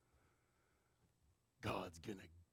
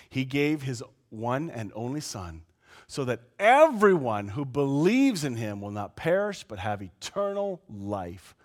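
usual speaking voice

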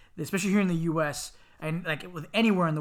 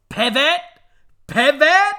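higher, louder questioning pivot; Friends pivot quote; 10 semitones higher, 11 dB louder, much slower than usual. Retold or imitated. Quoting Friends to a co-host, rising mock call. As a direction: toss out the Friends pivot line. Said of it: "That's from Friends".

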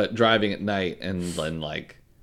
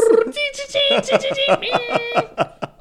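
high, loud screeching chirps; dial-up modem noise; 5 dB louder than usual. Retold or imitated. Mimicking dial-up internet sounds, laughing. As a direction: warble like a modem connecting.

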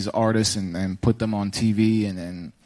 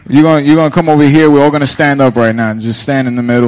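louder, quicker directing; 9 dB louder than usual. Real, not acted. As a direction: call everyone over loudly. Directing everyone on stage.

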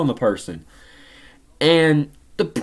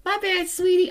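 put-on high, soft old lady apology; sweet grandma; 14 semitones higher, 5 dB quieter than usual. Grandma apologizing to her grandson, soft and high. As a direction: coo it like a sheepish grandma.

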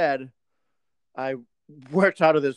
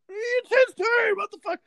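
much higher, slow exasperated protest; 4 dB louder than usual. Retold or imitated. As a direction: throw up your hands, pitched way up. Exasperated retold retort.

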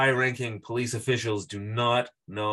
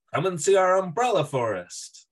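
cheesy tour guide; 8 semitones higher, 4 dB louder than usual. Pretend. Mock tourist trap ad.

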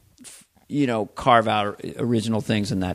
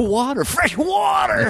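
high, drawn-out cry of wonder; mock amazement; 14 semitones higher, slower than usual. Comic marvelling at water from the faucet, amazed cry.

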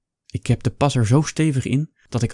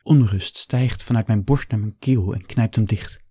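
usual speaking voice

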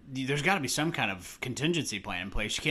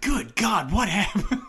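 loud, high mock-horrified outburst; mock shock; 8 semitones higher, 7 dB louder, slower than usual. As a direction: blurt it out in hammed-up shock, laughing at the end. Acted exclamation imitating movie character's shock.

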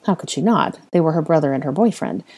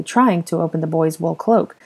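plain reading voice

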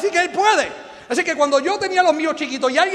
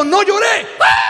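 hammed-up shout at a toddler, much higher and louder; mock parent losing it at the kid; exasperated yell; 7 semitones higher, 6 dB louder, slower than usual. Father yelling at his crying two-year-old, hammed up.

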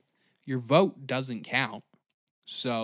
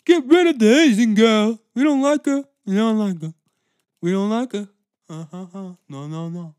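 loud, high put-on whiny fan voice; shrill mock of an angry fan; 8 semitones higher, 11 dB louder than usual. Ranting at Star Wars fans in a loud mocking fan voice. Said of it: "so you're like".

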